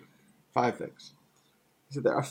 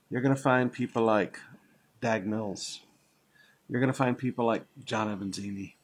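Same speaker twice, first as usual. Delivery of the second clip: ordinary delivery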